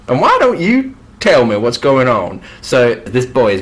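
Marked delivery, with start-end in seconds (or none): 0.1-2.4 higher and louder grand swindler; pompous conman king. Acted. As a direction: puff yourself up as a fake reverend. King voiced, same scene.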